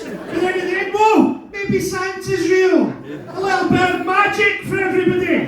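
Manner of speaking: high gushing delight; mock wonder. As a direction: gasp at the magic of Santa.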